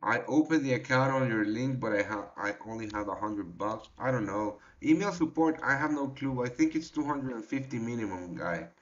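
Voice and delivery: normal talking voice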